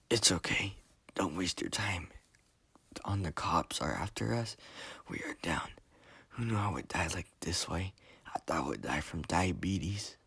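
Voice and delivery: soft, weak whispered voice. A narrator's reading. Wounded Enrique reassures Gio, whispering. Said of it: "Enrique whispers and says".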